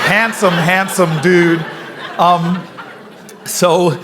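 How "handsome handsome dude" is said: slow, laughing "handsome, handsome dude"; laughing compliment. Real, not acted. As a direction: savor the compliment through a laugh. Flirty joke to audience member.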